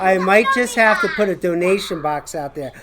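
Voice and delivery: laughing through the words; chuckling at her own joke. Real, not acted. Joke about buying pizza.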